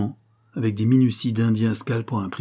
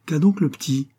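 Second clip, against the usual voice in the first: voix un peu plus haute, inquiète; un père soucieux de son fils; père inquiet; 7 semitones higher than usual. Acted. (père inquiet, voix plus haute)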